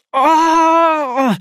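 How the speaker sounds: long, high, loud wail; drawn-out howl of pain; scream of pain. A narrator's reading. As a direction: let out an agonised scream. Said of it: "skrek jag".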